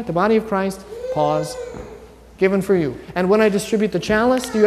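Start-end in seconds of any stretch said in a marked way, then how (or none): 0.0-3.0 body of Christ, pause, then given for you; pause before given. Shown as a demonstration. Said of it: "there's a space in between there?".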